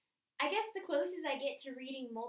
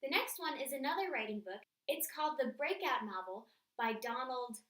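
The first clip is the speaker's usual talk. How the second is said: normal talking voice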